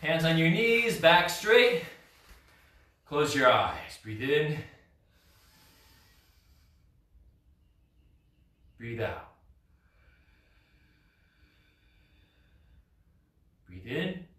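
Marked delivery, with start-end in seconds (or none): 3.0-14.2 slow, soft breathing cues with long pauses; calm the class down gently; guided breathing. Acted. Instructor guiding seated students through slow quiet breathing.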